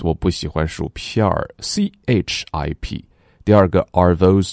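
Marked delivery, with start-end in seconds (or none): none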